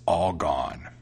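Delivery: low, slow cat reply; matter-of-fact cat. A narrator's reading. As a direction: draw the name out in a low voice. Cat answers, set against mouse.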